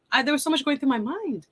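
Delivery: usual speaking voice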